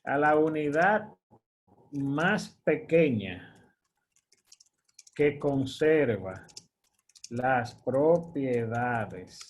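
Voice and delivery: slow, drawn-out words spaced by long pauses; slow dictation. Shown as a demonstration. Teacher typing a quiz question for students, slow dictation. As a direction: spell the question out slowly as you type.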